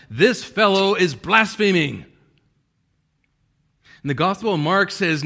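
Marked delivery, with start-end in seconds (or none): 0.1-2.0 loud, high, drawn-out indignation; outraged accusation. Acted. Pharisees accusing Jesus among themselves, outraged. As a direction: spit out the charge, stressing each word slowly. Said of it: "and that is anger".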